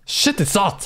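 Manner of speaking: normal talking voice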